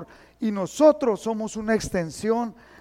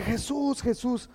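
a quiet, softer plea with a lift; soft pleading; 3 semitones higher, 6 dB quieter, slower than usual. Retold or imitated. The leper quietly calling out to Jesus. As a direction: call him softly, begging. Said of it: "le dice".

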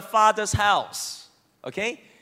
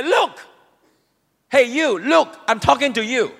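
rude demand; 3 semitones higher, 5 dB louder than usual. Acted. Son rudely addressing his father, loud. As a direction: snap at him to pay attention. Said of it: "he's so rude".

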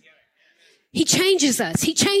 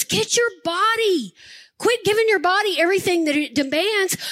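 normal talking voice

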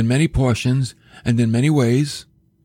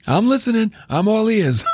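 high-pitched acted readiness to listen; eager compliance; 9 semitones higher than usual. Preacher acting out his reply to God, high eager compliance. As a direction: play a startled, obedient hearer, pitched right up. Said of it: "I'd be like".

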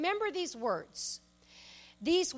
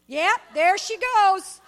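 slow, loud mock kids; kids teasing; 4 semitones higher, 11 dB louder, slower than usual. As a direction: drag it out, teasing mom. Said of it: "they both would just go like this".

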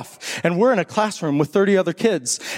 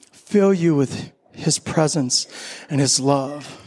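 communion liturgy; 4 semitones lower than usual. Acted. Pastor leading the congregation through communion, solemn and low. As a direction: speak the words of institution with hushed reverence.

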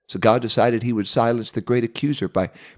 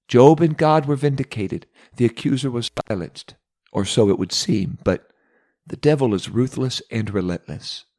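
ordinary talk, nothing marked

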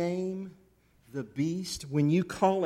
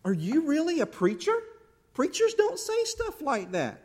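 scolding conscience; 8 semitones higher than usual. Acted.

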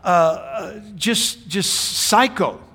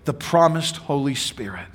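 normal talking voice

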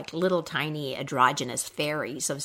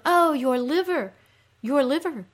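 high, groaning sympathy lament; echoed groan; 7 semitones higher, 4 dB louder than usual. Acted. Creatures commiserating with the suffering queen, echoed groan. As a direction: groan along with her in shared pain. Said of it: "the creatures groan".

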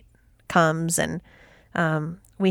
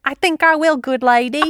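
higher, hammed-up mock Cockney; bad Cockney accent; 8 semitones higher than usual. Trying Leslie's awful Cockney for her co-host, a mock Cockney. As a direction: try the Cockney and fail on purpose. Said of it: "I'ma try it".

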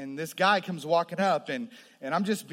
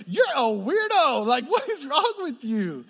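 high, loud mock yell, slow on weirdo; scoffing it at her like a rebellious kid; retold shout at mom; 11 semitones higher, 10 dB louder than usual. Son snapping at his praying mother, high and loud.

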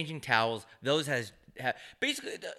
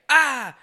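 high, loud mystic ah; magician's mystic ah; 13 semitones higher, 12 dB louder than usual. Retold or imitated. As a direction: cast a spell with it. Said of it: "Chris Angel just went like".

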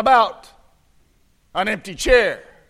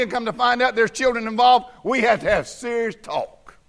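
normal talking voice

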